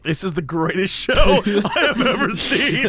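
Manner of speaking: laughing while saying it; delighted line broken by laughter; laughing through it. Retold or imitated. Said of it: "And I was like".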